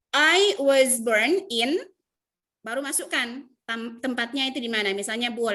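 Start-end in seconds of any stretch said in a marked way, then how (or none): 0.1-1.8 slow, loud model phrase. Shown as a demonstration. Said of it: "seperti ini dalam bahasa Inggris".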